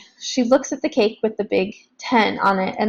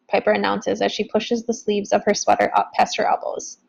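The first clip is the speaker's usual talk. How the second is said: ordinary delivery, nothing marked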